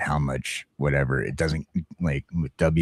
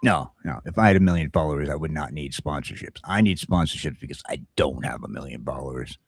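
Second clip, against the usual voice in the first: ordinary talk, nothing marked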